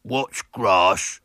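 lightly put-on throaty boy; a boy playing dumb in a fake voice; deep throaty voice. A narrator's reading. William in disguise baiting Miss Milton, mild throaty voice. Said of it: "he said in his deep, throaty voice".

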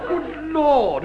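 startled high exclamation; surprised. Acted. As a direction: blurt it out in shock. Surprised at approaching woman.

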